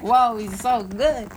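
slow, drawn-out mock amazement; imitating wowed fans; mock awe. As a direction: gush slowly like an impressed fan. Retold fans marvelling at driver.

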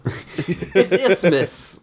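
very slow, stretched out; drawn out. Pretend. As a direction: stretch Isthmus way out. Drawn-out mock word.

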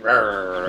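growl. Shown as a demonstration. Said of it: "just like".

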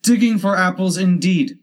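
high, loud indignant rabbit; cross, high-pitched retort; angry. A narrator's reading. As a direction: throw it back angrily at the servant. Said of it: "said the rabbit angrily".

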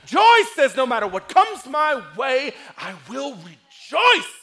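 loud, high, exultant sermon shout; full-force preaching. Acted. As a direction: belt out joy like a preacher on fire. Preaches at full force, voicing joy.

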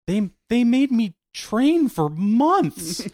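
high, drawn-out mock complaint; slow, whiny retold griping; Mark Hamill. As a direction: imitate an actor moaning about wasted effort. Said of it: "Quit your bitching".

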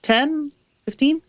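high, wavering guesses; vague and unsure. Acted. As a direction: drift between numbers carelessly. Guide unsure of time.

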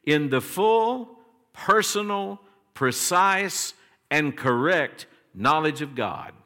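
full, personal, precise each lifted high and held; emphatic reading. Shown as a demonstration. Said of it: "notice this, this phrase".

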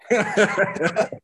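high burst of laughter. Real, not acted. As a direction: crack up laughing. Laughs at own joke.

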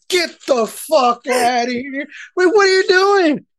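high-pitched, incredulous retort; indignant disbelief. Retold or imitated. Husband balking at the matching family shirt his wife made, indignant disbelief.

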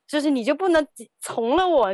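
high, pleading whine, laughing through it; exasperated plea. Retold or imitated. Wife whining at husband to just buy the trash bin. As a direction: beg him to just give in.

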